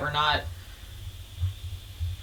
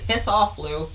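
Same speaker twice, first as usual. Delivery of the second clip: normal talking voice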